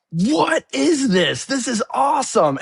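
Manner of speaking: put-on a child blown away at a sold-out show; amazed kid. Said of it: "and he's like".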